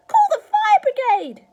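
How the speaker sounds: very high, very loud alarm; sudden shout. A narrator's reading. Grandma feeling her stomach burn, sudden shout. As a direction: burst out shouting for help. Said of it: "she shouted suddenly".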